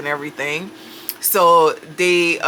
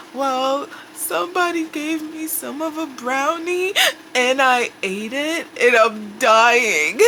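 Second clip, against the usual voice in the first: tearful confession; 7 semitones higher than usual. Retold or imitated. High woman confessing the brownie to paramedics, tearful whimper. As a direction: whine it through tears like a guilty child.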